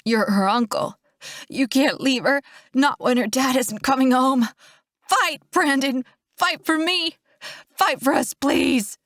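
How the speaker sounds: impassioned plea, rising in pitch; desperate urging. A narrator's reading. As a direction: beg him to fight for life. Begging dying brother to fight.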